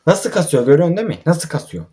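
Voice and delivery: normal talking voice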